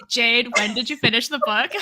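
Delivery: playful show-host question with a laugh; mock show host. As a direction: ask it like a podcast host putting her on the spot. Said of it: "the thing every show is like".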